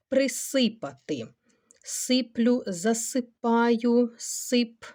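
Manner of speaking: normal talking voice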